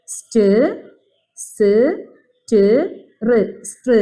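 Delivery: സ്റ്റ്, സ്, ട്, റ് as isolated sounds with long gaps, then str blended; sounding out letters. Shown as a demonstration. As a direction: dictate each sound alone before joining str. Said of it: "sound മനസ്സിലാവാനാണ്". (സ്, ട്, റ് ഓരോ ശബ്ദമായി നിർത്തി, പിന്നെ str)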